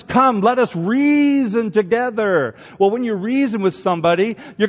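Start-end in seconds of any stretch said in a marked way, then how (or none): 0.0-2.6 slow, loud, inviting call; God inviting. Retold or imitated. God calling people to reason together, slow and loud. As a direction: draw them in to reason. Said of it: "God says".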